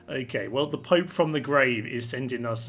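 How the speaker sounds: ordinary delivery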